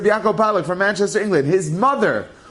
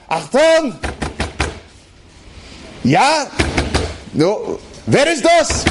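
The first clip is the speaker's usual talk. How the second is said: Nazi soldier shouting; 7 semitones higher, much slower than usual. Retold or imitated. Nazi soldier at the door, shouting German demands. Nazis pounding on hiding family's door.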